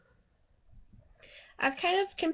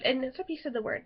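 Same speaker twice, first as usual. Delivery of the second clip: usual speaking voice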